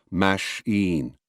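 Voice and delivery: "mash-een" slowly split in two weighted halves; equal syllable stress. Shown as a demonstration. Lecturer showing a wrong way, "mash-een" evenly stressed in pieces. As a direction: separate "mash-een", weight on both parts. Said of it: "never machine or".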